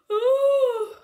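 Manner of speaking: big high loud ooh of surprise; loud surprised ooh. Real, not acted. Reader spotting the wolf's name, loud high surprised ooh.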